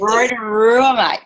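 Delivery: jokey, badly faked Australian accent; terrible Australian accent. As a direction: lightly put on a bad Aussie accent. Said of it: "That's my terrible Australian accent".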